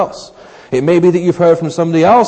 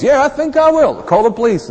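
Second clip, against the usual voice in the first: mock resolve; 9 semitones higher than usual. Agreeing to call police.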